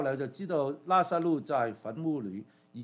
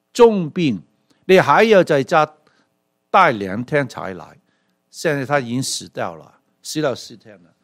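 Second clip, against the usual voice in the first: louder, reproachful sister scolding the late guest; angry complaint; 10 dB louder than usual. Retold or imitated. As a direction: hammer each grievance loudly. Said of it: "玛利亚有些气愤了".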